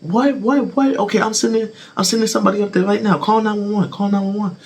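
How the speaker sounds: high, rushed panicked mother; fast high-pitched mom in a frenzy; panicking mom. Retold or imitated. Mom on the phone ordering her son to call 911, high panic. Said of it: "my mom's freaking out".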